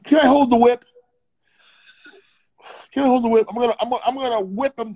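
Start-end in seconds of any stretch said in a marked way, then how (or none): 0.0-0.9 eager actor. Acted. Playing keen actor asking for whip.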